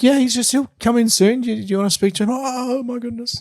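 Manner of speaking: high, excited fake British accent. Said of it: "The guy also wasn't British".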